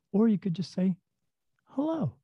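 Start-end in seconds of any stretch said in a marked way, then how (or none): 1.6-2.2 cheery greeting. Shown as a demonstration. Suggests greeting strangers instead.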